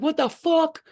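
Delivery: high-pitched retold shock; startled outcry. Teller reliving jumping up in a panic, startled outcry. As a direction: blurt it out high, as if jolted up.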